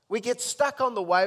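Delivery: full-force preacher cry; preaching shout. Acted. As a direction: hammer it home to the congregation. Repeat hammered home.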